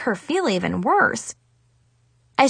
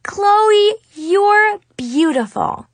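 loud, high, drawn-out gush; high-pitched adoring exclamation; 11 semitones higher, 5 dB louder, much slower than usual. A narrator's reading. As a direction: gush over a dear friend. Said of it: "and gushed".

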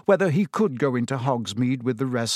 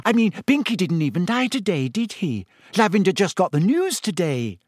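fast, higher know-it-all reasoning; brisk logic; 6 semitones higher than usual. A narrator's reading. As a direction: rattle off the logic quickly.

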